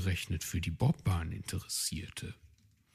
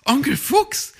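high, loud call, a bit louder; young hare calling eagerly; 10 dB louder than usual. Acted. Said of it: "rief Weißohr".

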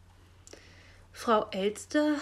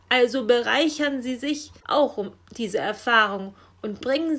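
loud, forceful raven orders with caws; commanding; 5 semitones higher, 8 dB louder than usual. A narrator's reading. Raven ordering Frau Elster to deliver, commanding and loud. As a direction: order them about, ending on a big caw. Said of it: "befahl der Rabe".

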